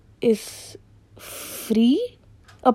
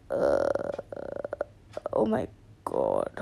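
whispered oh my God, much lower; embarrassed whisper; 11 dB quieter than usual. Real, not acted. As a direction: breathe it out in embarrassment. Embarrassed at own cringe.

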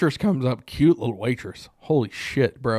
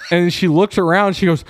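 ordinary talk, nothing marked

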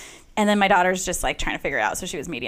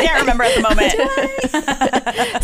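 louder, higher teasing quote through laughter; laughing mock quote; 5 semitones higher, 5 dB louder, slower than usual. Host teasing a guest who blanked on her pet, laughing mock quote. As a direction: tease slowly, laughing through the words.

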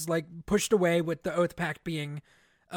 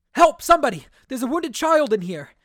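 higher and louder, a desperate shout for help; calling for help; 5 semitones higher, 7 dB louder than usual. Acted. Vale calling out over the wounded boy, loud desperate shout. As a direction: yell it out so anyone nearby hears.

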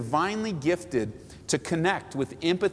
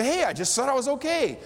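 caught-out surprise; 8 semitones higher than usual. Retold or imitated. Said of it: "You go".

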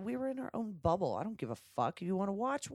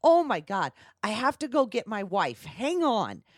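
louder, rushed excitement retold; excited quote; 4 semitones higher, 6 dB louder than usual. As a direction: act out his flustered thrill. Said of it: "he walked away, like".

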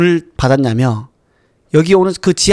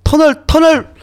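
rising higher and louder into a yell; frantic ordering shout; 10 semitones higher, 4 dB louder than usual. Retold or imitated. Lab boss orders the tunnel destroyed, rising shout. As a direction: build from urgent to panicked shout. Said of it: "이렇게 해요".

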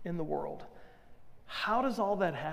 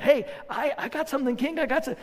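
eager, pitched-up herald; really excited; 7 semitones higher, 3 dB louder, faster than usual. Retold or imitated. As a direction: burst in high and eager. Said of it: "like, really excited".

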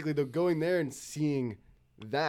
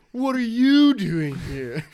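skit impression; 9 semitones higher, 10 dB louder, much slower than usual.

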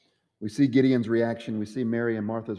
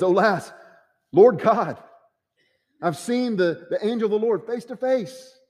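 higher, louder cry of awe; awed alarm; 9 semitones higher, 5 dB louder than usual. Acted. Gideon crying out on seeing the angel, in a higher, louder voice of awe.

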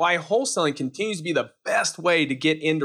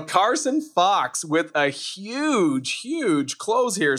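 announcer voice; 4 semitones higher, much slower than usual. Acted. Victory bell, announcing the close.

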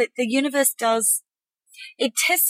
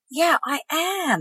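slow, higher, certain; certainty; 6 semitones higher, much slower than usual. Shown as a demonstration. Inner voice now sure, saying it with certainty. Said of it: "the next layer that says".

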